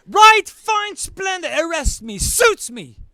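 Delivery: high, loud at first, then settling; father sarcastically daring the police to arrest him; sarcastic exasperation. Retold or imitated. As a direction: throw up your hands in mock compliance.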